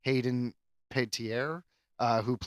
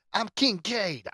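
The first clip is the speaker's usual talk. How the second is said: Kincaid movie quote; 8 semitones higher, slower than usual. Retold or imitated. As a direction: announce yourself like the tough guy from the film. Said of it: "He was like".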